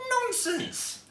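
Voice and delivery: put-on high, firm old lady's "nonsense"; decisive old woman's dismissal. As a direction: brush the fear aside kindly but firmly. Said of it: "said the old lady firmly".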